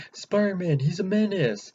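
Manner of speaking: high, loud scoffing accusation; scornful bystander. Retold or imitated. A guy on the street calls Spider-Man a menace, high loud scoff. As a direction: sneer it like a gossip. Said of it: "that guy says".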